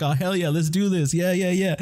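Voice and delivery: mock eager. His own eager reply, acted.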